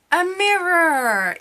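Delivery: high, loud, drawn-out announcement; excited reveal. Real, not acted. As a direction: sing out the big reveal. Mock-grand reveal of her purchase.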